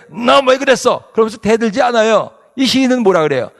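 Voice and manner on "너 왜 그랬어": high-pitched acted angry challenge; mock angry. Wronged person confronting the wicked, mock angry. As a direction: confront them, mildly hammed-up anger. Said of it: "그럼 화가 났는데".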